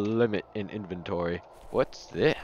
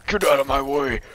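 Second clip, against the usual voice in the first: ordinary delivery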